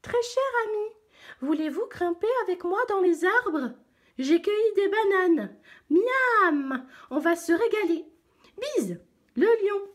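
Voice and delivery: very high-pitched monkey voice; eager monkey reading aloud. A narrator's reading. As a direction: read the letter in a high, excited squeal. Monkey reads his letter aloud. (singe enjoué, voix très aiguë)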